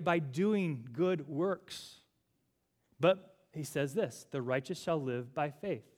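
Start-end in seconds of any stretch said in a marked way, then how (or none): none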